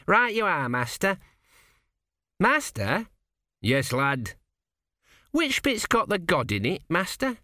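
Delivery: high, bright lad answering his master; chirpy apology; the apprentice apologising brightly to his master; eager young apprentice. A narrator's reading.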